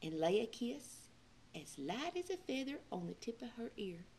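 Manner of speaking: soft whisper, barely voiced; hushed storyteller whisper; sneaky whisper. A narrator's reading. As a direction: whisper as if not to wake her. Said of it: "snuck up".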